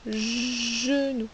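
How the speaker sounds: "genou" said slowly with a long buzzing first sound; drawn-out J sound. Shown as a demonstration. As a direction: hold the J at the start of "genou". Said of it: "c'est bien le son je".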